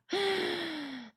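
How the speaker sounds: high, audible gasp; gasping inhale. Real, not acted. Teacher over-accentuating the breath, with a high gasp. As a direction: draw breath in sharply and audibly. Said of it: "It sounds like I'm gasping".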